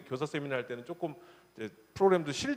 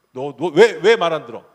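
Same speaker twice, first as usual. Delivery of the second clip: much louder angry mom; scolding mother; 12 dB louder than usual. Retold or imitated. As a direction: snap at the disobedient child. (아이를 야단치는 엄마)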